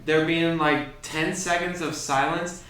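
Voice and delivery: normal talking voice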